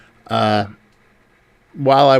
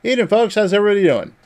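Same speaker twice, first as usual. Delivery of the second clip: cheery greeting; 9 semitones higher, 3 dB louder, faster than usual. Retold or imitated. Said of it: "I'm like".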